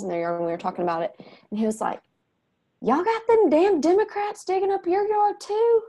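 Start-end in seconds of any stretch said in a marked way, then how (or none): 2.8-5.8 put-on much higher, eager kid asking the neighbors; mother imitating her son pestering the neighbors, higher and eager; little boy voice. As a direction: play the curious boy blurting it out. Child voiced in a retold story.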